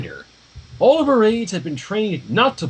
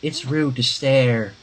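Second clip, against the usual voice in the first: deadpan Maud; 5 semitones lower, slower than usual. Acted. Maud speaks without turning.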